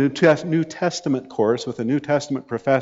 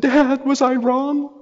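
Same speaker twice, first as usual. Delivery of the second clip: high, drawn-out plaintive wail; much higher and slower, mock-crying plea; imitated tearful daughter; 13 semitones higher, slower than usual. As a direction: imitate a daughter whining to her dad for reassurance. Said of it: "she called me crying one day".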